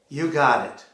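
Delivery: usual speaking voice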